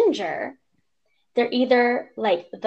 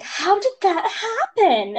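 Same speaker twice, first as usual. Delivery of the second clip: high, slow, louder mock question; nosy guy; 10 semitones higher, 4 dB louder, slower than usual. Mom imitating a prying man at Trader Joe's, high and slow. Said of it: "guy was like".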